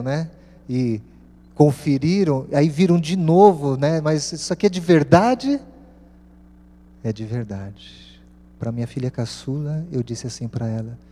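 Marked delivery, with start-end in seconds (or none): 4.0-5.6 higher, louder disbelieving question rising on VERDADE; incredulous question. Retold or imitated. Daughters' disbelief at the cheque, acted. 7.0-8.2 slow, softer falling answer; calm confirmation. Retold or imitated. Father's answer confirming it is real.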